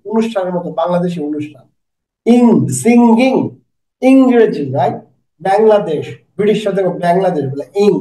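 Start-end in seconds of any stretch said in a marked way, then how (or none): 2.3-4.6 ইং in সিঙ্গিং and ইংরেজি said louder, ringing in the nose; loud nasal ng; ng demo. Shown as a demonstration. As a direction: punch the ইং through the nose. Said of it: "ING সাউন্ডটা হচ্ছে ইং".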